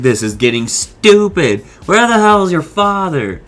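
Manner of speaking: exasperated Brock. Retold or imitated. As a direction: snap the question like you're sick of this. Brock fed up in tomb.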